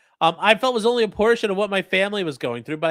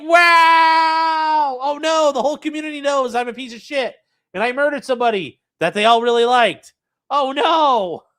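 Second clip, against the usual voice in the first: higher, louder mock dismay, fast middle; 6 semitones higher, 5 dB louder than usual. Streamer mocking killer's dismay at being known, mock panic. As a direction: ham up the shock at being found out. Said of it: "You're like".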